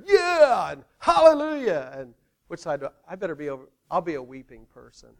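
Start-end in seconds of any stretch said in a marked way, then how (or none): 0.0-1.9 high, loud Yeah and Hallelujah; demo cheer. Shown as a demonstration. Pastor showing the hallelujah side how to cheer, high and loud. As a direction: show them how to cheer it. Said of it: "so you guys are".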